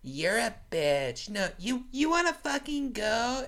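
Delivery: mock American tough guy. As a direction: puff up like an American picking a fight. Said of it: "Americans are just more like".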